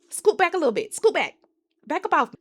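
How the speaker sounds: high, loud, sassy warning; mock telling-off. Telling a character to back off.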